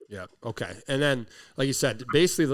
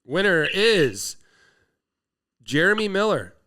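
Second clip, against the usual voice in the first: slow dramatic winner reveal; announcer voice; 2 semitones higher, 3 dB louder than usual. Acted. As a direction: build suspense, then name the winner. Drum roll prize announcement.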